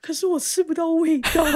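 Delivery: high, whiny joking complaint; mock complaint. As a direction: play up the complaint as a joke. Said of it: "我朋友会开玩笑说". (开玩笑地抱怨)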